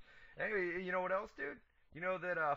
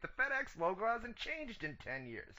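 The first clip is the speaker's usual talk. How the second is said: pitched way up in disbelief; exasperated high protest; 12 semitones higher than usual. Real, not acted. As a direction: squeak out the obvious, annoyed.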